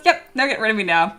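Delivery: ordinary delivery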